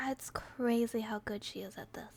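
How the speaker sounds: whispering. Real, not acted. Whispered aside during video.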